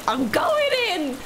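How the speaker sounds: high, slow mock-heroic boast; Crocodile Dundee. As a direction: ham up a tough adventurer. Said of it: "like Crocodile Dundee".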